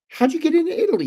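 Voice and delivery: high-pitched, astonished retold question; amazed mock squeal; incredulous. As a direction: act out a parent's disbelief, voice shooting up. Retold amazed question to young son.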